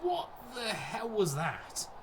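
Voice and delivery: high, slow, incredulous what the hell; stunned at the keeper's blunder; drawn out in disbelief. Real, not acted. Manager watching his keeper blunder.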